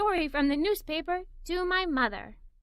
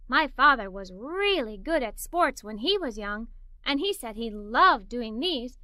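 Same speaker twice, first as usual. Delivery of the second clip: ordinary delivery, nothing marked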